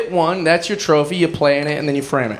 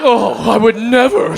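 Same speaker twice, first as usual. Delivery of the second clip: prim mocking; 3 semitones higher than usual.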